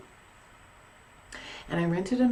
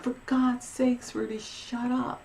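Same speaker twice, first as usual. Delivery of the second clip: higher, drawn-out retold complaint; mock exasperation; 7 semitones higher than usual. Woken owner to the cat, exasperated high scolding. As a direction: scold the cat, fed up and slow.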